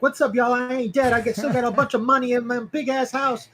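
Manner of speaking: high, loud brash kid-rapper imitation. Friends mocking [name], loud high brash imitation. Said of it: "being like".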